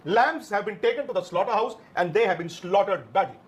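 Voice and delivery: very slow, each word weighed; slow dramatic verdict. Real, not acted. Pundit slowly pronouncing the team slaughtered, grave and drawn out. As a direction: drag it out like a funeral announcement.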